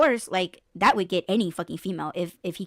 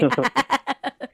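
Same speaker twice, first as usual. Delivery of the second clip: loud, high laugh; burst of laughter; 5 semitones higher, 4 dB louder than usual. Real, not acted. A woman cracks up at her own impression, loud laugh. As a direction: crack up at your own joke.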